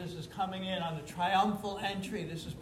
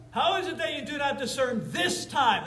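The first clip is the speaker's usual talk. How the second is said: higher, louder, thundering challenge; forceful rebuke; 7 semitones higher, 5 dB louder than usual. Acted. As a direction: fling the question at the crowd. Preacher repeats Jesus' rebuke.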